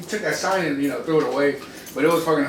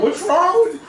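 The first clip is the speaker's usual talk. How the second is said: high-pitched alarmed retelling; scared; 13 semitones higher, 4 dB louder than usual. Retold or imitated. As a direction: imitate a brother spooked out of his wits. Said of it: "he got scared for my life".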